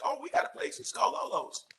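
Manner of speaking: excitedly recommending a spot to a friend; eager suggestion. Retold or imitated. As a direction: pitch it up, eager to share the place. Retells eagerly suggesting a restaurant.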